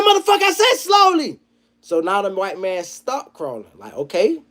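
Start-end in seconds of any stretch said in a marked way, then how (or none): 0.0-1.4 much higher and louder, furious repeat; angry cop yell. Retold or imitated. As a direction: snap it at him like an enraged cop. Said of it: "He said".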